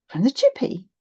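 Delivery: put-on child hoping for a takeaway; hopeful. A narrator's reading. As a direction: ask it with hope in the voice. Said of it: "I asked, hopefully".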